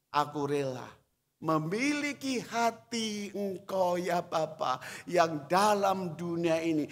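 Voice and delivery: ordinary talk, nothing marked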